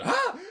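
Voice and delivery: sudden high-pitched yelp; high pained yelp. Retold or imitated. Zipping costume, Deadpool yelp.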